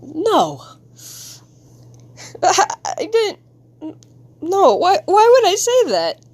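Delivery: high, stammering panic; falter, then climb into high disbelief; horrified regret. Acted. Kid horrified at own words to parent, panicked regret.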